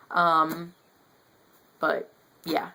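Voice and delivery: normal talking voice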